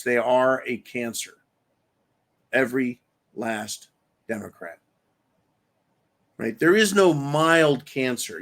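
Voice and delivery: ordinary talk, nothing marked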